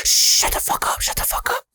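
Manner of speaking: harsh urgent whisper; whispered order to shut up; big brother's hushing. Retold or imitated. Big brother silences the panicking kid, fierce whisper. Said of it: "he's just being big brother right here".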